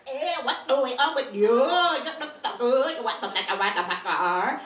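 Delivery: loud, laughing mock Vietnamese accent; twangy rubber-band Vietnamese accent. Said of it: "sounds like rubber bands".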